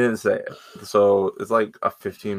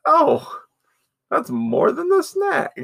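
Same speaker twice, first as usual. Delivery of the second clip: squeaky mock outrage; 5 dB louder, slower than usual. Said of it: "I'm like".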